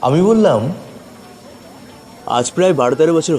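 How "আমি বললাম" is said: ordinary delivery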